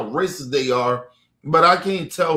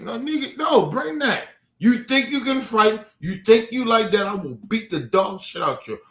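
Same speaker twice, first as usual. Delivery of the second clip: daring challenge; 7 semitones higher than usual. Real, not acted. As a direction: dare them to step up. Challenging imagined opponents.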